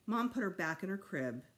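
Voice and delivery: ordinary delivery, nothing marked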